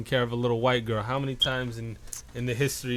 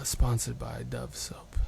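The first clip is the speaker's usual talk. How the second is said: hushed, slow sponsor read; NPR host; 2 semitones lower, 7 dB quieter, slower than usual. Acted. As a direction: softly mimic a public-radio announcer. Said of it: "where they talk like this".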